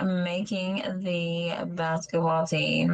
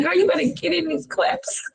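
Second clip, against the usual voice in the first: retold urging; 10 semitones higher than usual. Retelling urging another mama to clap.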